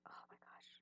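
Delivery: tense whisper. Real, not acted. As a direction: whisper it, cringing. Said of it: "I'm really cringing right now".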